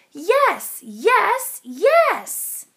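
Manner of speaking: loud, rising cheer of yes; shouted yes. A narrator's reading. Kids answering the driver, loud excited shouts.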